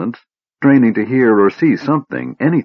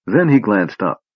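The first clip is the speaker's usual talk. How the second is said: ordinary delivery, nothing marked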